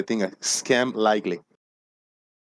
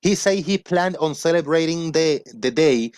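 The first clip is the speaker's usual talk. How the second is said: higher-pitched announcer delivery; news read; 7 semitones higher than usual. Acted. Reads a mock news item.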